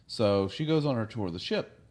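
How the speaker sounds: ordinary talk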